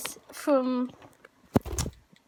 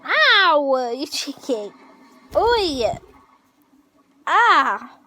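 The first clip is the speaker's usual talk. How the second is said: startled yelps; 7 dB louder, much slower than usual. Real, not acted. Bird pecking at eyes.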